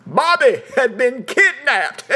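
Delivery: high, slow, loud mock indignation; mock outrage. The boys telling the police their dummy was stolen, hammed-up outrage. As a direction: stretch it out like a shocked victim. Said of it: "we'd say".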